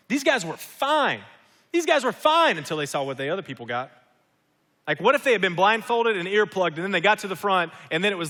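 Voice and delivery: loud, high, fervent preaching; preaching at full force. Acted. Pastor pressing home God's goodness to the congregation, loud and fervent. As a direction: drive it home to the whole church.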